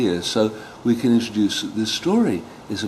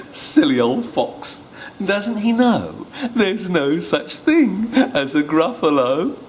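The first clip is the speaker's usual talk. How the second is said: slow, higher, smug little mouse; 9 semitones higher, slower than usual. Acted. The mouse mocks the fleeing fox, slow and smug. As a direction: chuckle at the fooled fox. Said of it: "mouse laughs at fox".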